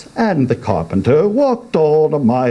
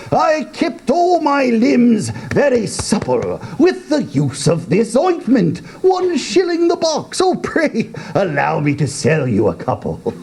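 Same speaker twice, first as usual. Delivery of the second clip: put-on higher, sly sales-pitch old man; sly old salesman; 11 semitones higher than usual. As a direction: hawk the ointment with a wink. Sage as salesman.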